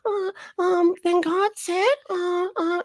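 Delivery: put-on very high, halting little-kid voice; toddler playing prophecy. Host imitates a toddler grabbing for attention, squeaky toddler voice. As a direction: stumble along in a squeaky tot voice. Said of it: "and then they start doing the".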